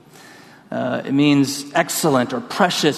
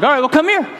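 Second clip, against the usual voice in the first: calling out; 11 semitones higher, 3 dB louder, faster than usual. Retold or imitated. Calling to the truck.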